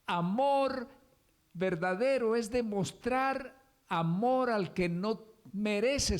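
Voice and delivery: amor proclaimed high and loud; ringing. Acted. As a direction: fling out amor at full voice. Sermon outburst on true love.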